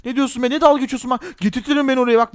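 ordinary talk

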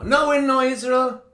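high, loud no, we're not Israel; emphatic rebuttal. Real, not acted. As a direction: slam the correction home. Preacher rejects replacement theology.

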